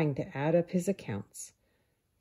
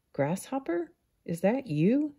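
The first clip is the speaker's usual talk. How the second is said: much higher, shocked question; higher surprised call; startled discovery; 7 semitones higher than usual. Acted. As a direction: gasp out his name in alarm. Ant finds friend in snow.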